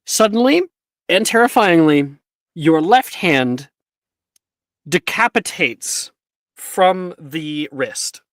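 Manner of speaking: slow, loud, spooky storytelling; dramatic narration. A narrator's reading. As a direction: build dread like a campfire tale.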